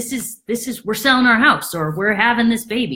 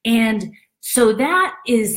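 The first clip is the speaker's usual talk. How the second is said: ordinary talk, nothing marked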